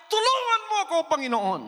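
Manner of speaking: fast rising to a high loud cry; building to a cry. Acted. Woman clinging to the Lord, building to a shout. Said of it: "sumigaw siya".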